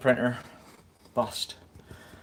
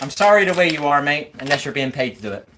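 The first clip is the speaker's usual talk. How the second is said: ordinary talk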